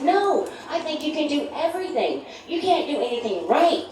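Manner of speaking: high, mocking comeback; sarcastic reply. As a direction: cut him down sweetly. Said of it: "And I said".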